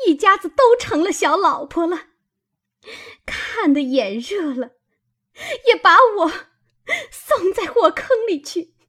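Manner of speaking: high, loud tirade breaking into tears; tearful cursing. A narrator's reading. Maid cursing her sister-in-law over the concubine match, furious and tearful. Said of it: "指着骂道".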